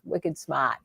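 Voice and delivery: quiet Boston "wicked smart"; understated Boston accent. Retold or imitated. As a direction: drop the R like a Bostonian, softly. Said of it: "I do like the way they say".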